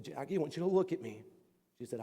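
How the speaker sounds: put-on soft, intimate voice to a child; hushed, kindly comfort; tender reassurance. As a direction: speak gently and quietly, just to him. Lady tenderly reassures the boy.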